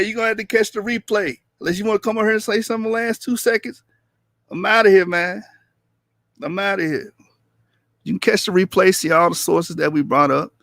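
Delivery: ordinary talk, nothing marked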